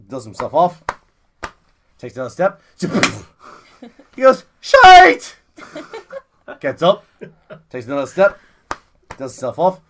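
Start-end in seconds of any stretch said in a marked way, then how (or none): 4.6-5.4 very loud, high curse; drunk shout. Acted. Drunk man falls a second time, loud high curse. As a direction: yell it out on hitting the ground.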